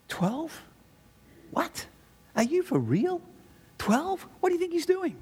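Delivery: mock outrage at the number; playing the shocked opponents, pitch climbing; incredulous.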